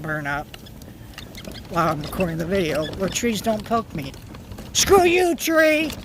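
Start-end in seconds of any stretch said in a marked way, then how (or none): 4.7-5.9 loud mock outburst at a tree; playful yell. Real, not acted. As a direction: shout at the tree. Yelling at poking tree.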